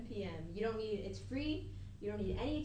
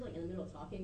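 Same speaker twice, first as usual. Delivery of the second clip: normal talking voice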